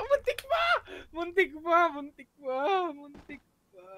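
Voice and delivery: spent, dwindling near-miss relief; fading relief. Real, not acted. Relief after near miss, laughing.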